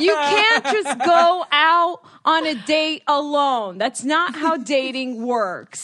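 loud, slow, high-pitched mock lecture; hammed-up exasperated dad; squawking dad. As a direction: imitate an outraged father spelling it out. Retold dad objecting, squawking.

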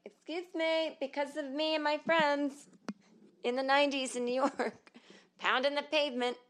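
hammed-up telling-off, pitched well up; mock indignant retort. Veteran comic imagining setting a younger comic straight, mock indignant.

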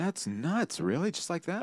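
high, amazed infomercial stooge; infomercial buffoon. Acted. Co-host agreeing with the sales pitch, amazed buffoon. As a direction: marvel like a gullible sidekick. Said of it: "the buffoon is great".

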